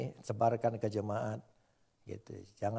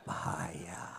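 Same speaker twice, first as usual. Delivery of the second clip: whisper; 8 semitones lower, 9 dB quieter than usual. Acted. Whispered warning aside.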